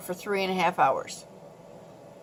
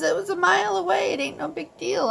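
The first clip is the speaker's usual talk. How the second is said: dismissive husband; 8 semitones higher, faster than usual. Retold or imitated. Husband dismissing the bear worry.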